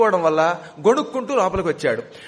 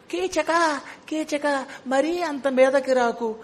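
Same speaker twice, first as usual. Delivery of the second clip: Bhima disguising his voice as a coy woman; woman's voice; 6 semitones higher, much slower than usual. Retold or imitated. Bhima teasing Keechaka in a feigned woman's voice. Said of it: "అని ఆడగొంతుకు మార్చి".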